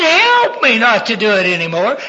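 high, loud, imploring cry; acted child's plea. Daughter prays for help after disobeying, pleading. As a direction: act a child begging for help.

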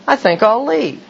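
mock-wounded pride; huffy exit. Huffy reply in retold scene.

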